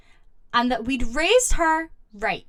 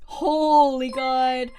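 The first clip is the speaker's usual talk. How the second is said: usual speaking voice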